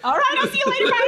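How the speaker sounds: high goofy mock voice, laughing; high mock farewell. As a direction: squeak a cheery goodbye.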